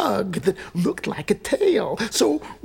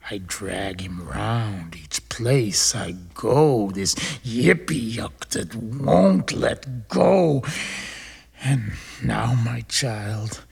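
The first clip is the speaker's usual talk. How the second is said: weary poem recital; 12 semitones lower than usual. Acted.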